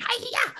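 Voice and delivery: very high-pitched martial-arts yelp; hammed-up karate chop yell; mock karate cry. Queens overselling the spy movie, mock karate cry.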